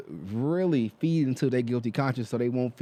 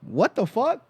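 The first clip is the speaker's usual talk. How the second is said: incredulous; 9 semitones higher, slower than usual. Real, not acted. Outraged at the faked accent.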